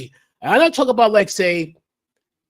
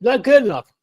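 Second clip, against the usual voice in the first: mock snooty dismissal; 4 semitones higher than usual. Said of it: "turn your nose and say".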